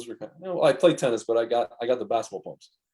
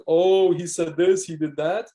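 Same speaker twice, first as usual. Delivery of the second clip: louder, slow, sing-song tattling; mocking gossip; 3 semitones higher, 5 dB louder, slower than usual. Media twisting what he said, slow mocking gossip. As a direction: parody of petty high-school gossip.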